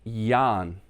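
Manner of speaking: Jan said as "Yahn", long vowel; slow, clear "Yahn" for Jan; Danish name pronunciation. Shown as a demonstration. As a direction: say Jan with a Y sound and drawn-out "ah". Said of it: "that is pronounced".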